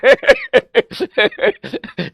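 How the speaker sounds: a real, easy laugh trailing down; amused laugh. Real, not acted. Teacher amused by his own croaky voice, laughing.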